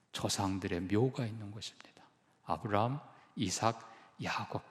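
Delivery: hushed. Real, not acted. Listing patriarchs softly.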